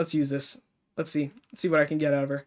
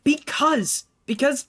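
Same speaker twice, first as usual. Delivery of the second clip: put-on bratty girl argument; 6 semitones higher than usual. Voicing Yan and Alice arguing.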